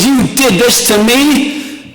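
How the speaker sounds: high-pitched panicked rant at his wife; hammed-up new-dad panic; freaking out. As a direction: voice up high, retelling the panic half-laughing. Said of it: "I was freaking out".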